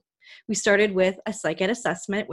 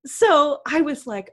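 usual speaking voice

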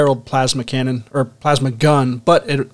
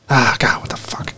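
whispered curse under breath; whispered mutter; 2 semitones lower than usual. Real, not acted. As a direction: mutter it to yourself, voiceless. Muttering while searching book.

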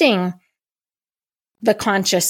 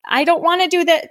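much higher, small pleading voice of the body; a meek, higher inner voice refusing; body's small voice; 8 semitones higher than usual. Retold or imitated.

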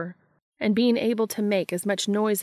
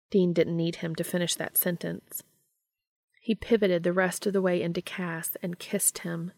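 ordinary delivery, nothing marked

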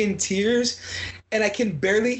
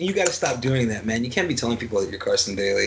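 deadpan Carson Daly; 11 semitones lower, faster than usual. Retold or imitated. Voicing Carson scolding Aries Spears.

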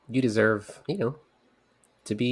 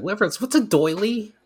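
slow, high-pitched mock question; imitating puzzled listeners; 12 semitones higher, slower than usual. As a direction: ask it high and drawn out. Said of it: "She's like".

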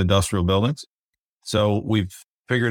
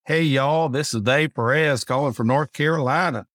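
playful North Carolina twang, a bit higher; joking North Carolina accent, pitched up; put-on Southern accent; 5 semitones higher than usual. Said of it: "that one seems like it's made up".

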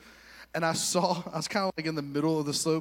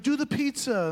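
high called advice; 9 semitones higher, 4 dB louder than usual. Retold or imitated. Students calling advice.

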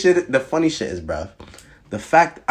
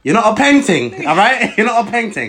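louder, higher mock London accent; put-on British accent; 9 semitones higher, 5 dB louder than usual. Insulting a friend playfully, louder put-on British accent. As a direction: tease in a hammed-up British accent. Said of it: "in a British accent".

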